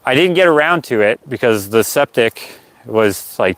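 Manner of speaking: ordinary talk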